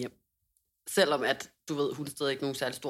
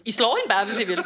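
louder, higher quote through laughter; imitating mother; 4 semitones higher, 9 dB louder than usual. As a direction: mimic a fed-up mother, laughing. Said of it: "Min mor, hun var bare sådan".

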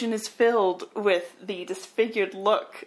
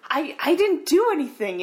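higher, louder stammering denial; mock panic; 7 semitones higher, 5 dB louder than usual. Man denying guilt to a ghost, mock panic. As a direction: plead your innocence in a fright.

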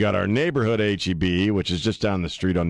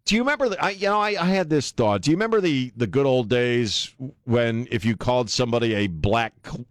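normal talking voice

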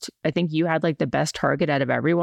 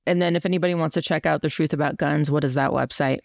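ordinary talk, nothing marked

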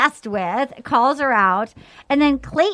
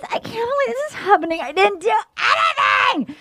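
high, fast mock sob with rising voice; mock crying; 8 semitones higher than usual. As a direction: whine it like a fake cry. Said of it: "She's crying".

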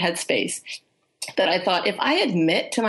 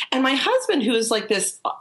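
normal talking voice

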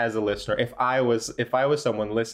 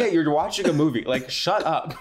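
dismissive; 6 semitones higher than usual. Retold or imitated. Mocking the movie watchers.

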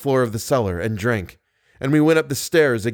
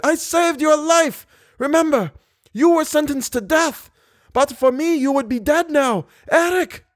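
frantic pleading. Acted. Drowning man pleading with Eric to save him, panicked. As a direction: beg for your life in terror.

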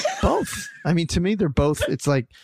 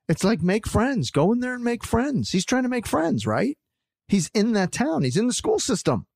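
heated; 9 semitones higher than usual. Real, not acted. Heated argument about Dean.

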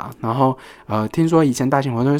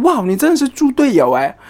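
exasperated thought; 8 semitones higher than usual. Retold or imitated. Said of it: "我会想说".

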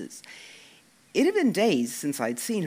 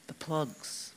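much softer, leaning in to confide; hushed; 9 dB quieter than usual. A narrator's reading. Leaning across the table to tease Dec, hushed. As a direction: drop the voice so nobody hears. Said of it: "keeping my voice down".

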